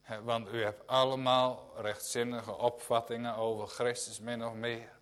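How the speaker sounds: normal talking voice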